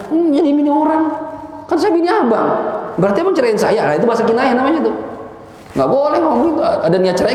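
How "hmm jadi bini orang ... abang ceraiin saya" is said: high, put-on wife voice, taking the words literally; a wife catching her husband's words, voiced high; wife's voice. Teacher voicing a wife who catches her husband's words, high-pitched.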